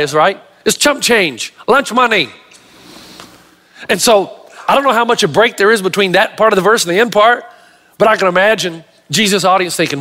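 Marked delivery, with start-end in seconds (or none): none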